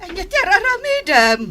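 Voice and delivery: high, loud startled question; shocked mother demanding what's going on; alarmed cry. Acted. Mother finds her daughter crying and demands answers, alarmed.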